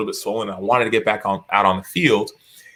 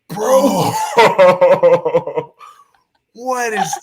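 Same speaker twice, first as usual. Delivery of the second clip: high, louder "bro" cracking into laughter; laughing disbelief; 8 semitones higher, 5 dB louder, much slower than usual. Retold or imitated. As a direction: imitate a teammate who can't believe it. Teammate reacting to swollen face.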